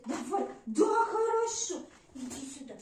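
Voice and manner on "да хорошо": high, loud praise for the dog; high excited praise. Real, not acted. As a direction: squeal a delighted yes. Praising dog after fetch.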